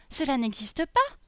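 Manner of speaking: put-on high, rising little-girl exclamation; dismayed little girl. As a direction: voice a small girl in disbelief. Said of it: "dit la petite Lolisa".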